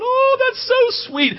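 very high, slow cooing parents; mock cooing. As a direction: coo like doting onlookers.

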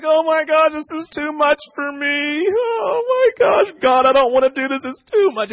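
high, whiny mock panic with a cracking cry; overwhelmed panic. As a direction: ham up a disciple falling apart under the burden. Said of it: "it wasn't weighty as in like".

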